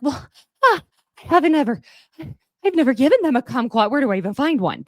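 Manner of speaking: high, loud flustered parent caught out; a parent panicking because they never bought one. Retold or imitated. Parent told kids need kumquats, in a high flustered voice. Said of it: "And then you're like".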